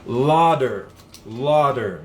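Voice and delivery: Lauder said as LAW-der, stress on the first syllable; pronunciation demo. Shown as a demonstration. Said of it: "Deixa eu ouvir aqui a pronúncia".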